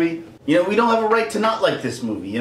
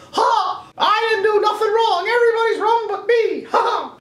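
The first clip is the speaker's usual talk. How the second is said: much higher cackling cartoon mouse voice; Mickey Mouse gloating. Mickey Mouse to fans, high smug cackle. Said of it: "Mickey Mouse is standing up there going".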